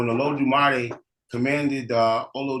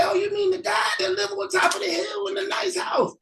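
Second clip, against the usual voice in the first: much higher, eager local voice; helpful townsfolk. Acted. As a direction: point the way, full of cheer. Townsfolk answer.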